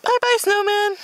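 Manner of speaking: singsong goodbye. Real, not acted. Waving goodbye to snowman.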